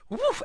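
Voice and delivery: high puff as sinuses clear; sharp "whoof". Retold or imitated. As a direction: blow out a high, startled whoof. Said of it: "it clears your nostrils".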